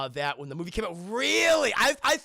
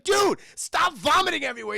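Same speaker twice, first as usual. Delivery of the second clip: put-on high, loud kid's complaint; mock exasperated shout; 10 semitones higher, 8 dB louder than usual. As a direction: yell at your friend in imitation. Acted boy scolding vomiting friend.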